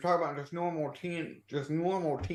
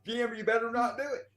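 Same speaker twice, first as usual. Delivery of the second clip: high-pitched, excited warning; 7 semitones higher than usual. Real, not acted. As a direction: warn Jim off, pitch jumping up. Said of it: "It was the inflection in his voice".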